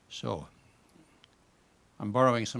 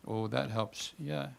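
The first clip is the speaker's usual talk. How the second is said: usual speaking voice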